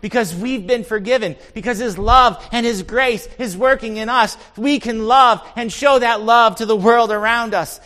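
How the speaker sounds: loud, high, driving sermon voice; impassioned preaching. Real, not acted. Preacher telling congregation what Jesus does in us, impassioned. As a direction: preach it out with conviction.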